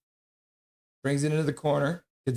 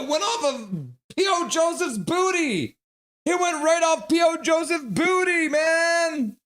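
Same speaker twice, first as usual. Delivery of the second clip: much higher, shouted disbelief; incredulous outburst; 12 semitones higher than usual. Real, not acted. Streamer shouts in disbelief at a fluky goal. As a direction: blurt it out in exasperated disbelief. Outraged at lucky deflected goal.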